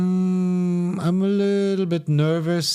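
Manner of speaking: high, hesitant worried doctor; nervous German doctor. Retold or imitated. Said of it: "You speak to the German doctor".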